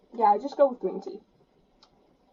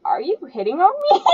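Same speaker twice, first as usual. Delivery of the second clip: fast, high playful accusation; teasing; 7 semitones higher, 6 dB louder, faster than usual. Acted. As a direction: needle him playfully. Character teases the player.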